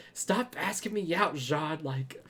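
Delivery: mock rant rising in volume; mock yelling. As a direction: imitate an exasperated telling-off, building. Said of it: "She's yelling at me".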